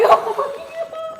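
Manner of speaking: very high, slow, laughing squeal; the cat slaps the curtain, high squealing laugh. Retold or imitated. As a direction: let it rise into a laughing squeal. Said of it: "I'm like".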